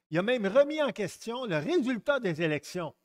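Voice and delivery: ordinary talk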